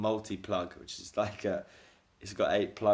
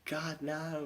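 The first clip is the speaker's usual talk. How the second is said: very high, long anguished wail; drawn-out high mock howl of defeat; mock battle cry; 9 semitones higher, much slower than usual. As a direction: imitate a gamer's distant despairing cry. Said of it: "battle cry from his room".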